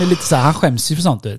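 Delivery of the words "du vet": usual speaking voice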